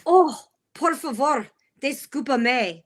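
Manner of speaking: Spanish phrase. Retold or imitated. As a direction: recite a learned Spanish sentence carefully. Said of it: "he goes".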